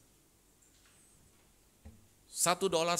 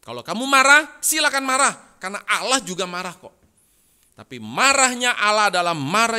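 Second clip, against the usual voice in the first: loud, high preaching as Jesus; Jesus teaching loudly; 7 semitones higher, 11 dB louder than usual. Acted. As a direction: proclaim it to the disciples at full force. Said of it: "Yesus mengajar para murid-muridnya".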